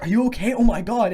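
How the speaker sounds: alarmed concern. Retold or imitated. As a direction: imitate a shocked passerby checking on someone hurt. Strangers alarmed at crash victim.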